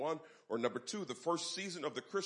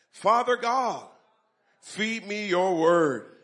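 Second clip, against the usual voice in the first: loud, rousing church call-and-response; preaching; 3 semitones higher, 10 dB louder than usual. Acted. Pastor leading the church, loud preaching voice. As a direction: lead the congregation in a fervent confession. Opening confession and prayer at full force.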